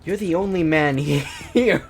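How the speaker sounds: breaking up laughing mid-line; can't get through it without laughing. Real, not acted.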